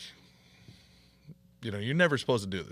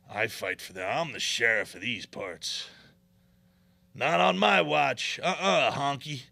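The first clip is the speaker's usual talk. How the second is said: mock sheriff hero; 5 dB louder, slower than usual. Voicing Adam as self-declared sheriff.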